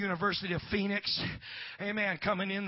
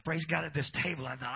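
normal talking voice

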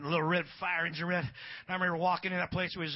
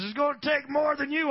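loud, raised-pitch retort; biker's comeback; 8 semitones higher, 9 dB louder than usual. Retold or imitated. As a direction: throw the challenge back loudly. Biker answers the challenge.

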